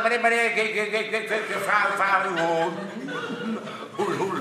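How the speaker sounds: stammering man; stutter. Acted. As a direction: stutter and repeat every word. Same stutterer asks again.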